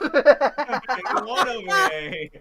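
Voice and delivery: high, loud, drawn-out through laughter; laughing echo. Real, not acted. Echoing a co-host's joke about the OVA, laughing hard. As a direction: crack up while repeating the joke.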